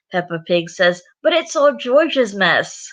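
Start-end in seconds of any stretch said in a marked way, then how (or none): 1.2-2.9 high, drawn-out complaint; childish protest. Acted. Peppa blames George for the mess, childish protest. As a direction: whine it like a child dodging blame.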